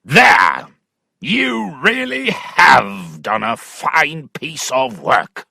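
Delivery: loud opening shout, then slow sarcastic growl; angry shout. Acted. Angry father shouting at Allworthy, sarcastic fury. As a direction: bellow it, then slow down in scorn. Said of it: "shouted at him".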